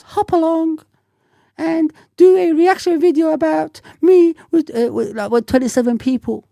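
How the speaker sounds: mock a grown man who sounds like a little girl; prissy little girl. Mocking a troll's message in a high girly voice. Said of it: "What kind of grown-ass man talks like that".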